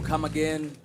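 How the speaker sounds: ordinary talk, nothing marked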